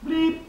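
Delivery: little cartoon noise; mock bleep noise. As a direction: pop out a sudden high bleep. Dwarf peeing noise.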